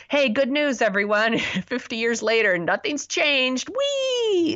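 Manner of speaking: mock-cheerful announcement rising to a high squeal; sarcastic cheer. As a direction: announce bad news as if celebrating, ending in a drawn-out squeal. Sarcastic cheer at grim conclusion.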